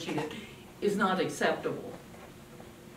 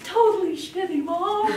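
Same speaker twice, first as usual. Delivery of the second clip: put-on high, louder teen complaint; mock teen whine; 10 semitones higher, 5 dB louder than usual. Teen daughter answering mom.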